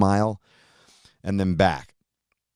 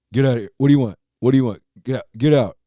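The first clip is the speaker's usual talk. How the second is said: put-on louder, snappy teen telling dad to get out; grumpy teenager; 4 semitones higher, 4 dB louder, much faster than usual. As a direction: bark it like a moody teen. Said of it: "Being a teenager".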